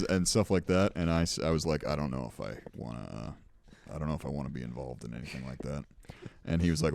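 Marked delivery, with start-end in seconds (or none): 1.9-5.8 soft, low, fast hedging mumble; reluctant. Retold or imitated. Refusing the neighbour's scheme, soft and rushed. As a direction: back away from the plan quietly.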